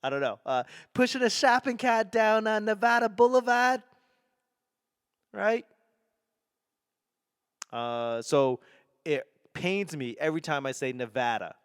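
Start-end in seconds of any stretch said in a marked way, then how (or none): 0.9-5.7 high, put-on Boston voice; Boston accent. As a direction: lay on a thick Boston accent, pitched high. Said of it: "that's so Boston".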